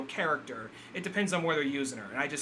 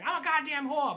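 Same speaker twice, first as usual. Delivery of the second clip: much higher mock Brooklyn accent; 12 semitones higher than usual. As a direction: squeak it out in Brooklyn twang. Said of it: "she does have that Brooklyn accent".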